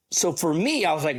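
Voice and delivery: usual speaking voice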